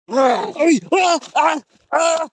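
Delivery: very loud, high screams; screams under attack. Real, not acted. Searcher suddenly attacked by a creature, screaming. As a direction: scream out in a burst of short, high yells.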